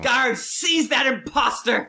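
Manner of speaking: high imperious command; evil queen. Acted. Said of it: "I'll turn into Trant Thumble".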